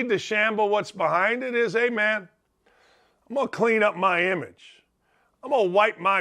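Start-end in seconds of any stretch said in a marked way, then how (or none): none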